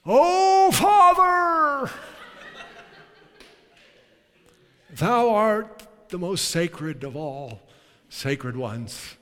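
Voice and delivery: booming, high, drawn-out mock prayer; put-on pious front. Shown as a demonstration. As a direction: intone it like a pompous, fake-holy prayer. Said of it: "Don't put on any fronts".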